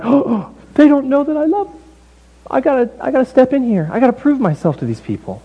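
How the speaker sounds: high, hammed-up dismay; mock-concerned God. God overhearing the people's complaint, high mock dismay. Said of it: "overhear it and be like".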